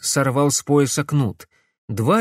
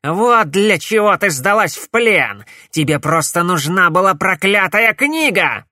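loud, much higher accusing yell; angry shout; 7 semitones higher, 6 dB louder than usual. A narrator's reading. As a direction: rage at the betrayal. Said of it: "злобно крикнул Корнелиус".

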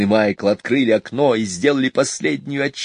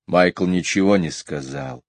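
ordinary delivery, nothing marked

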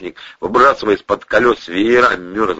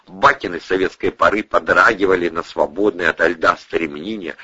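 ordinary delivery

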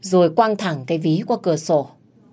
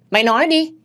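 high, loud shouted demand; raised-pitch angry outburst; angry yell; 10 semitones higher, 5 dB louder, faster than usual. A narrator's reading. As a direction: explode on the repeated demand. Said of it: "Tôi gào ầm lên".

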